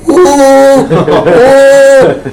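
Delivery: long, high, loud train whistle hoot; imitated train horn. Storyteller mimics train coming at the stuck car, horn hoot.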